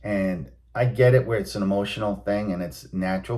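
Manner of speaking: usual speaking voice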